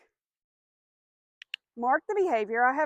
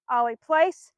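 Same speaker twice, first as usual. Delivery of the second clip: firm command; 7 dB louder, slower than usual. Real, not acted. Commanding the dog.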